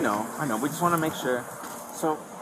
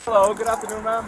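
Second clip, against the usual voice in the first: raised, higher voice calling across to her; 8 semitones higher, 7 dB louder than usual. Real, not acted. Officer calling to a woman he approaches, loud and friendly. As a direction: call out a friendly greeting from a distance.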